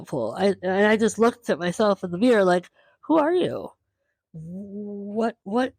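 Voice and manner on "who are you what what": high-pitched amazed questioning; astonished at your own reflection, pitched high; retold disbelief.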